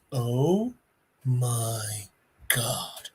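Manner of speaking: soft, low, breathless awe; awed hush. Retold or imitated. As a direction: melt at the sight of a puppy. Peacemaker sees tiny puppy.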